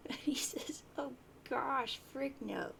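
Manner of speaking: reading through leftover giggles; words pushed out while still laughing; trying to read but still amused. Real, not acted.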